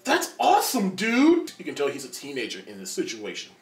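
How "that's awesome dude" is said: put-on high, loud, giddy teen gush; squeaky excited fanboy, laughing; starstruck teen. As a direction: gush like a kid meeting his hero. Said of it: "He's like".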